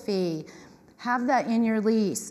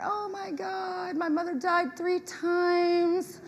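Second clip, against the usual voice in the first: slow, mock wailing drama queen; mock sobbing tenant dragging out every word; 7 semitones higher, 3 dB quieter, much slower than usual. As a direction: ham up the tearful tenant, slowly.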